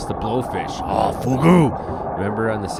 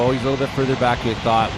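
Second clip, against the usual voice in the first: usual speaking voice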